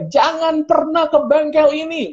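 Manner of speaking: slow, very high-pitched mock proclamation; indignant warning. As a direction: stretch it into an outraged public warning. Acted angry post to followers. (peringatan geram dibuat-buat, nada tinggi)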